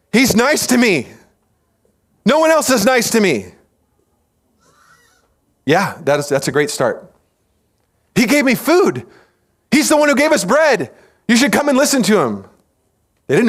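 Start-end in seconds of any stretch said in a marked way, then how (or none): 0.1-3.6 high-pitched naive follower; earnest, much higher voice; acted simple misfit. Misfits explaining why they follow. 8.1-12.5 high-pitched naive enthusiasm; eager, much higher voice; acted eager follower. Follower urging others toward Jesus.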